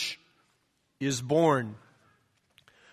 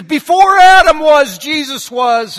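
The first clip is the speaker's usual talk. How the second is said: preaching shout, higher and louder, slow at the end; revved-up preacher; 8 semitones higher, 10 dB louder than usual. Retold or imitated. Preacher gets revved up before his congregation, mock shout. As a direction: fire up and proclaim. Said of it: "now he's getting kinda revved up".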